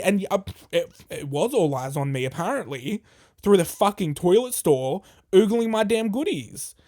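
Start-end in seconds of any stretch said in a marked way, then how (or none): none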